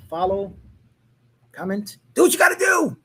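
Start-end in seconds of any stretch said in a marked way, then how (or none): 2.1-3.0 shouted. Real, not acted. Outro call to viewers.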